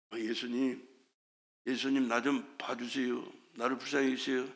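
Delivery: very soft, low mumbled request; feeble plea. Shown as a demonstration. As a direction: plead half-heartedly, barely audible. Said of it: "그렇게 해가지고는 만나 주실 수가 없어요".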